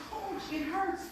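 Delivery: high, strained plea; hurting player. Retold or imitated. Player pleads with coach, high and strained.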